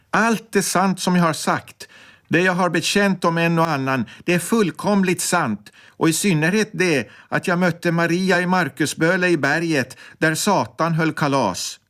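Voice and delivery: condemned woman proudly proclaims to the crowd, pitched high; proud calling out. A narrator's reading. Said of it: "Ropa så högt som hon kan och med stolthet i rösten".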